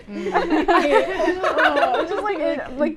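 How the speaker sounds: high, slow, laughing; drawn-out laughing mumble. Real, not acted. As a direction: laugh through the words. Laughing through disgust.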